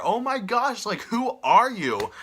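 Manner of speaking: high, slow indignant question; shocked and offended; imagined rebuke to the intruder; appalled disbelief. Shown as a demonstration. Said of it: "I'm just gonna be like".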